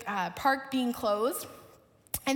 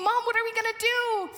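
imitated yelling child; 8 semitones higher than usual. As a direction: panicky kid calling to mom. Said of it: "he starts yelling".

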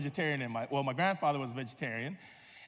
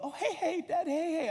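put-on high-pitched little girl's excitement; delighted daughter; 11 semitones higher than usual. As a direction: imitate a child thrilled by her favourite. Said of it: "she loved".

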